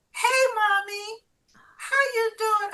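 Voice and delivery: put-on high, bright child's greeting; little girl's voice. Little daughter greeting her mother on the phone, high. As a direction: chirp it up like a small daughter.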